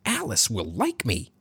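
high-pitched, preening silly voice; silly squeak. A narrator's reading. Arthur strutting like a peacock, silly squeaky voice. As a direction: squeak it like a self-admiring show-off. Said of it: "he squeaked in a silly voice".

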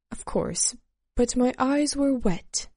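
ordinary delivery

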